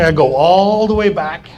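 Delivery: loud, lengthened "all"; drawn-out ALL. Retold or imitated. Telling of the next morning's trip, drawn-out "all". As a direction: drag out "all" for comic distance.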